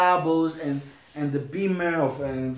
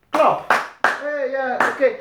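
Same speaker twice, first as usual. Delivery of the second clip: sharp loud high call to clap; 7 semitones higher, 4 dB louder, slower than usual. Real, not acted. Preacher calling the church to clap, loudly. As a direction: bark it out to the room.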